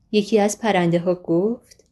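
plain reading voice

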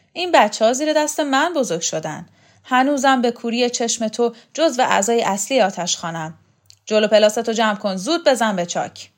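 loud, indignant telling-off; angry scolding. Acted. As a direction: berate him and send him packing. Heroine scolds the official.

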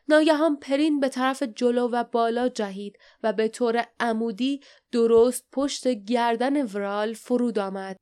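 usual speaking voice

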